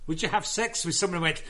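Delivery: ordinary talk, nothing marked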